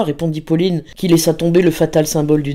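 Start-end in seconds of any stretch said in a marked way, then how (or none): none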